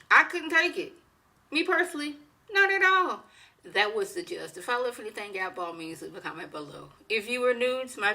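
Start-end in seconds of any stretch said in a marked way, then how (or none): 0.1-3.2 higher, insistent no way; firmly refuse, voice rising; emphatic refusal. Real, not acted.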